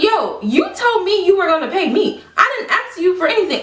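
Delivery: high, defensive retort; indignant protest. Retold or imitated. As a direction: re-enact setting a friend straight, pitch up. Acting out her protest to friend.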